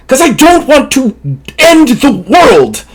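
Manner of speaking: high, drawn-out desperate plea; desperate protest. Acted. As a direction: stretch the words in anguished protest. Gunman pleads in a quarrel.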